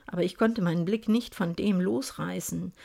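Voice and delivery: plain reading voice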